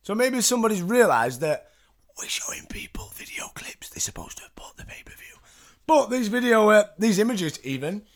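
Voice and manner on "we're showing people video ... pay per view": secretive aside in a whisper. Real, not acted. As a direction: whisper it like a guilty confession. Aside about pay-per-view footage.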